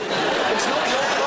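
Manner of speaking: laughing through the words; teasing while cracking up. Real, not acted. Teasing Australian guest, laughing.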